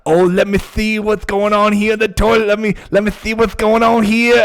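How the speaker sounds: Dusty's voice. Retold or imitated. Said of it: "Dusty's like".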